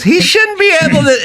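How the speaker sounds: high, hammed-up outraged celebrity reporter; mocking gossip host. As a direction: squeak it out like a shocked gossip reporter.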